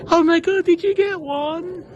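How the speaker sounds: high, delighted gasp of a question; imitating the guard's thrilled surprise; excited surprise. Train guard thrilled to see his headrest. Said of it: "she said".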